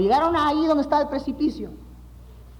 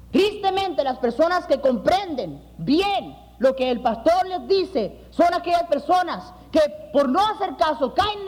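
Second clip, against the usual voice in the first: earnest preaching; 4 semitones higher than usual. Acted. Pastor preaching to his congregation, earnest sermon voice. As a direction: preach it to the hermanos.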